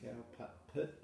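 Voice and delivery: cow pat pit in a New Zealand accent; Kiwi accent. Shown as a demonstration. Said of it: "when you say it with a Kiwi accent".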